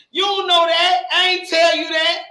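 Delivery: heated yelling at a friend; angry shout. Real, not acted. Woman confronting a friend on stream, shouting angrily. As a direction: shout it out, furious and loud.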